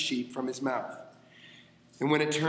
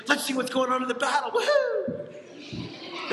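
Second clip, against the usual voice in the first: much higher, fast, whooping with a laugh; excited kid; 10 semitones higher than usual. Retold or imitated. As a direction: cheer like a thrilled little boy. Little kid eager to see battle.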